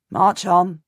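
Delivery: plain reading voice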